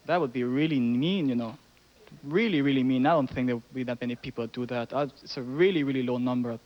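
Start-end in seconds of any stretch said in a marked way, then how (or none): none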